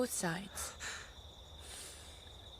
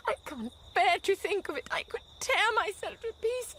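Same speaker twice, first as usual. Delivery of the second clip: much higher, louder, desperate cry; anguished outburst; 10 semitones higher, 5 dB louder than usual. Acted. Wife tells her husband of her despair, in a high anguished cry. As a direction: let the horror burst out of her.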